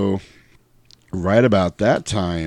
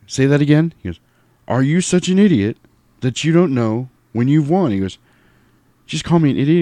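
acted film quarrel; 6 semitones higher than usual. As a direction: play both men squaring off.

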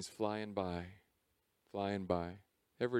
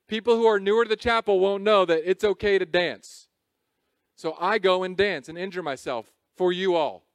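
high, loud, driving sermon voice; fired-up pulpit delivery; impassioned preaching; 9 semitones higher, 10 dB louder than usual. Acted. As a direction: preach it full out to the room. Preaching with rising force.

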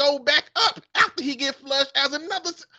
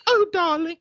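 put-on woman's British accent; 6 semitones higher, 4 dB louder than usual. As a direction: purr it back like a smitten English lady. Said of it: "man and a woman accent".

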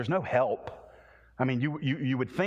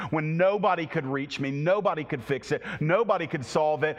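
usual speaking voice

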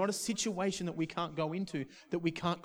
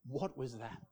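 awed, tearful hush; 5 dB quieter than usual. Retold or imitated. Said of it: "just burst into tears".

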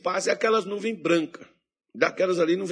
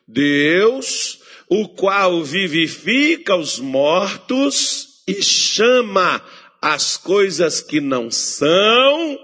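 loud, high, slow scripture proclamation; preached scripture reading; 4 semitones higher, 6 dB louder, slower than usual. Acted. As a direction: proclaim each word of scripture. Reads scripture with force.

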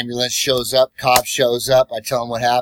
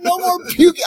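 high, loud valet shout; high teasing shout; 5 dB louder than usual. Retold or imitated. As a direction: yell it across the lot.

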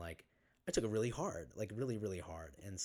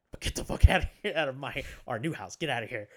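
louder, higher kicking-out rant; mimicking his stepdad yelling get out; mock angry parent; 6 semitones higher, 14 dB louder than usual. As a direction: imitate the stepdad throwing him out. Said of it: "And so they're like".

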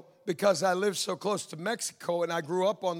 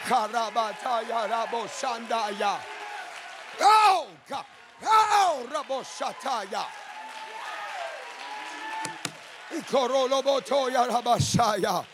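speaking in tongues; 4 semitones higher than usual. Real, not acted. Preacher praying before the church, speaking in tongues. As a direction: pour out unknown syllables in fervour.

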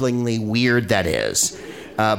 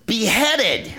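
laughing; 11 semitones higher than usual. Real, not acted. Laughing echo.

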